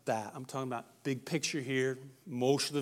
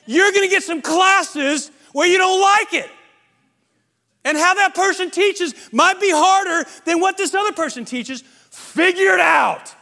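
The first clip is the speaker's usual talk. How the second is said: much higher and louder, half-laughing tough love; exasperated parent shout; 17 dB louder than usual. Retold or imitated. Parent yelling at a teen to study harder, mock shout. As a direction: yell it at a lazy kid, slow on figure it out. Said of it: "I didn't yell this loud".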